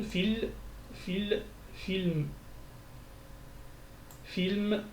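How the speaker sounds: "fil" first, then "film", each sounded out slowly and separately; building up the word. Shown as a demonstration. Teacher spells film syllable by syllable for learners, sounding each piece. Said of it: "on entend que c'est un soukoun".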